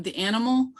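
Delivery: ordinary talk, nothing marked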